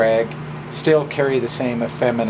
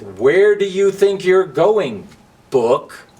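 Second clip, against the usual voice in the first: louder, higher, slow challenge; bossy Match; 6 semitones higher, 5 dB louder, slower than usual. Acted. Match stopping Book on the way to school, demanding. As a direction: block the way like a schoolyard bully. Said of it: "And Match begins with".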